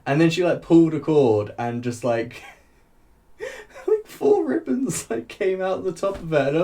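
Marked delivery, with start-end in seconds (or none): 3.4-6.6 giggling through the words as they come out; real amused laughter spilling over the words; laughing. Real, not acted. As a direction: laugh while you describe it, voice jumping up high. Laughing while describing the wig.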